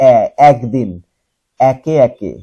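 এ in একদিন and একে opened to "æ", as in "cat"; correct open vowel. Shown as a demonstration. Teacher models the corrected words for students, open vowel in এ. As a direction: say একদিন as "æk-din", একে একে as "æke æke". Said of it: "এটার উচ্চারণ হবে".

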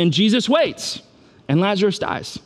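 ordinary talk, nothing marked

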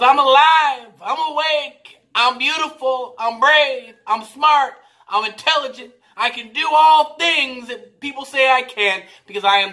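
high, loud jubilant declarations; exultant daughter. Retold or imitated. Daughter proclaims affirmations to mom, loud high joy. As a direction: belt out each affirmation like a joyful child. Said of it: "started to repeat these things louder and louder".

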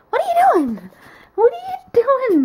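high, playful pet voice; high talking to the dog. Real, not acted. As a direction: ask the dog in a high coo.

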